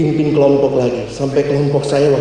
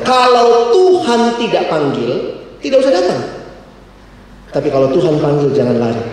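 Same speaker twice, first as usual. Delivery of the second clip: Stephen Tong. Retold or imitated. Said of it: "saya ingat loh kalimat Bapak Stephen Tong".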